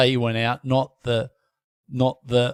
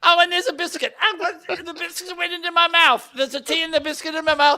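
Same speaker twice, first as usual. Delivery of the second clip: high-pitched hammed-up outrage; flustered posh dismay; 14 semitones higher than usual. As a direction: splutter in high, posh disbelief. Biscuit and tea hit Newton.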